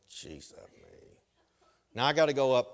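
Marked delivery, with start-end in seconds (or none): 0.1-1.2 low, slow, much softer mock groan; resigned groan. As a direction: sink into defeat at the middle seat. Realising he got the middle seat.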